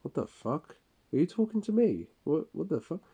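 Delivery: high, incredulous what the fuck; retelling his own baffled reaction to the coworker; startled retort. Retold or imitated. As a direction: snap back in disbelief. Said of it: "I look up and I go".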